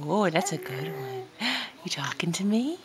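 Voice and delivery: playful whisper rising at the end. Real, not acted. Whispered playful question to baby.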